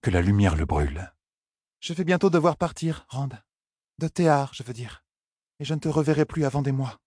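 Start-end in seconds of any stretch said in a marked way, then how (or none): 1.8-7.0 high-pitched heroine, hesitantly direct; high feminine voice, self-correcting; nervous young woman. Acted. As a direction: speak high, working up to a confession. Heroine leads up to confession.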